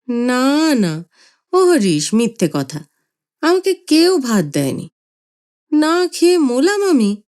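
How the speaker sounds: put-on old man near tears; childish whimper. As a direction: grandfather sulking and whimpering. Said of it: "ছেলে মানুষের মতো খুঁতখুঁত করে কান্না".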